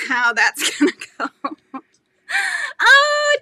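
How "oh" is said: high, loud squeal of delight. Real, not acted. Greeting a viewer with a squeal of delight. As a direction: squeal as a friend arrives.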